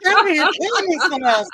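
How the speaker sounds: loud, high laughing protest; laughing loudly. Real, not acted. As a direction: burst out laughing at him. Cracking up at co-host.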